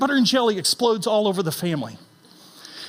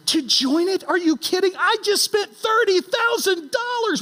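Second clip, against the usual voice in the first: incredulous outrage; 9 semitones higher than usual. Retold or imitated. Outraged at joining the stimming.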